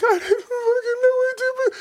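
very high, laughing-crying wail; mock sobbing. Young man sobbing to the angry father. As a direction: wail it like you're breaking down. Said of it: "tears streaming down my motherfucking face".

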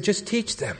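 a small, imploring mock appeal; tearful plea. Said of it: "he was weeping his eyes out".

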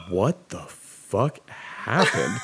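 very slow, spaced-out words; stunned disbelief. Retold or imitated. Retelling wife seeing the bruised shoulder, slow stunned disbelief. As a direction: draw out each word in mock shock.

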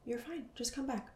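soothing herself. Retold or imitated. To herself when drifting, soft tender coaxing. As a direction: gently coax yourself back, like comforting a child. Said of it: "I would say".

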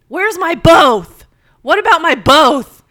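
loud, high, slow shouted demand; patient confronting the nurse, shouted demand; in-your-face demand. Retold or imitated. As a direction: get right in her face and yell it. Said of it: "gets right in this nurse's face".

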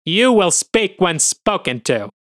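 snapping. Acted. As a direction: put the machine in its place. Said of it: "Astorga snaps".

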